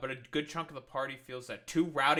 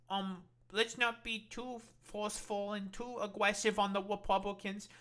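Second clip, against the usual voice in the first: mock timid voice; 3 semitones higher, 4 dB quieter than usual. Mocking timid Democrats.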